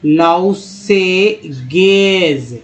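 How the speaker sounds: drawn out slow, syllable by syllable; spelled out piece by piece. Shown as a demonstration. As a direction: sound out each piece slowly. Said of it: "लॉन्ड्रेट्स और last में है लॉन्ड्रिंग्स".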